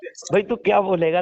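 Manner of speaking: ordinary talk, nothing marked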